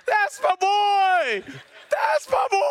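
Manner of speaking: high, slow proud shout, rising to a yell; proud dad shouting. Retold or imitated. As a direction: cheer like an over-the-top T-ball dad. T-ball dad yelling.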